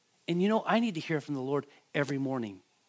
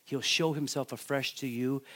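ordinary talk, nothing marked

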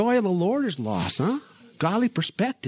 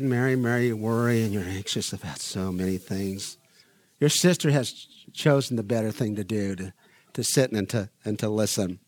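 much lower and softer, calm; low soft reassuring voice; gentle Jesus; 9 semitones lower, 3 dB quieter than usual. Retold or imitated. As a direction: soothe her worry quietly. Jesus gently answering Martha.

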